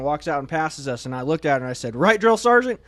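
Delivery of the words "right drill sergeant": high, loud, cocky; cocky question. Retold or imitated. Recruit cockily addressing a passing drill sergeant, high and loud. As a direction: toss it off with swagger.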